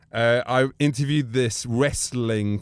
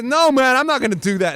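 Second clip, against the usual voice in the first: mock frat refusal; 9 semitones higher, 5 dB louder than usual. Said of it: "it goes".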